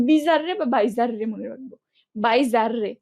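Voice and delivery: বিজার রে and বাইজার রে split syllable by syllable; spelling pronunciation. Shown as a demonstration. Teacher spelling bizarre aloud for memory. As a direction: sound out বাইজার রে to remember the spelling. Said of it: "বানান মনে রাখার সুবিধার্থে".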